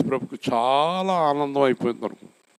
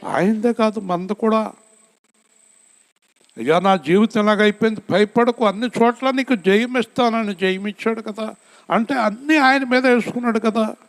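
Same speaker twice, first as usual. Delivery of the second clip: fervent preaching; 10 semitones higher than usual. Acted. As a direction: lift the congregation with a fervent cry. Preaching at full force.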